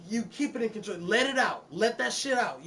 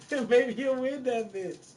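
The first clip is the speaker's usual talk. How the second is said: laughing; 6 semitones higher, 3 dB louder, slower than usual. Real, not acted. Repeats the line, laughing.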